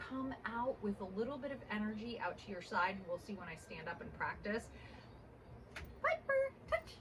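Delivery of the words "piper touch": bright high call of the name. Real, not acted. Calling the puppy's name.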